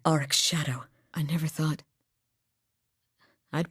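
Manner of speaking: hushed, breathless whisper; an awed whisper at a dark discovery. Acted. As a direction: breathe the words, barely voiced. Awed discovery in game scene.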